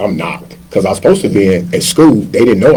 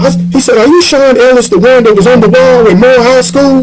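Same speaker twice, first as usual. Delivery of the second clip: curious fan; 12 semitones higher than usual. Retold or imitated. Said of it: "he was like".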